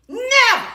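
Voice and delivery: high, punched "Never"; emphatic. Real, not acted. Woman boasting he can't win an argument, emphatic high "Never". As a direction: swoop high on "Never", then drop.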